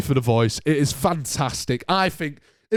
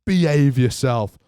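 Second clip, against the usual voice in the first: ordinary talk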